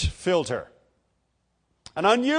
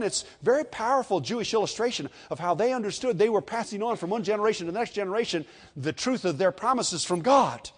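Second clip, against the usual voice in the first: ordinary delivery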